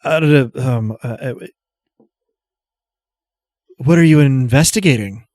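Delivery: put-on nervous young man, stammering. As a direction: stumble over the words nervously. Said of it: "he faces you and stammers".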